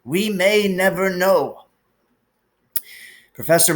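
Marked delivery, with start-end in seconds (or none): 0.0-1.7 higher, slow and measured; gentle sorrowful old wizard; wise old headmaster, lightly voiced. A narrator's reading. Dumbledore figure held.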